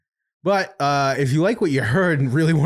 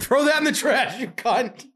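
disgusted yell; 12 semitones higher than usual. Real, not acted. Sees used wax strip, grossed out.

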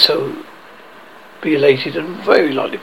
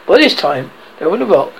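ordinary delivery, nothing marked

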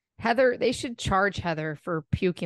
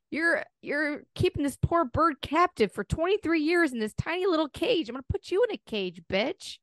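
retold rant; 7 semitones higher than usual. Ranting at bird owner, retold.

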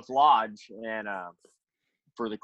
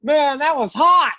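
high, loud, drawn-out rave; hammed-up fan approval; imitating impressed dad; 13 semitones higher, 7 dB louder, slower than usual. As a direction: stretch it out high and loud, thoroughly won over. Acts out dad's delighted verdict.

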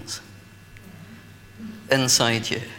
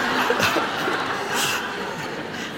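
lingering laughter; 6 semitones higher than usual. Real, not acted. Still laughing at the story.

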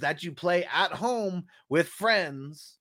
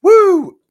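loud high-pitched "woo"; sharp, sustained falsetto-high woo; whoop; 15 dB louder than usual. Real, not acted. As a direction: let out a big high whoop between coughs. Coughing hard after a dab.

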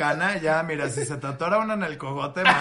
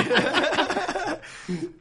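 a high-pitched burst of real laughter; 12 semitones higher than usual. Real, not acted. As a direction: let a high laugh escape. Laughing at the story.

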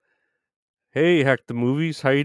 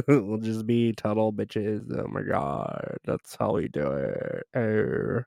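mock gossiping girl; 5 dB quieter than usual. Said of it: "That's how I do this voice".

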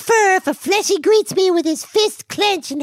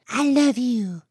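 soft, lower I love you; tender whisper-soft; 7 semitones lower, 4 dB quieter, slower than usual. Shown as a demonstration. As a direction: murmur it lovingly. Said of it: "you tell her".

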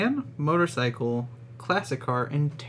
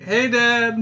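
higher and louder, a sheepish hello; calling out to dad; 10 semitones higher, 6 dB louder than usual. Acted. Son waving to his dad at the game, higher and louder. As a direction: call out to dad awkwardly.

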